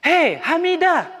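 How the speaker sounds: high, slow mock call of "Hei, Hamidah"; teacher acting someone calling the child. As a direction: call her name across a room, playfully. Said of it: "kalau ada orang manggil".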